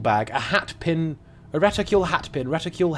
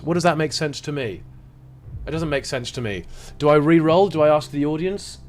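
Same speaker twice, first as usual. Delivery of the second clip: normal talking voice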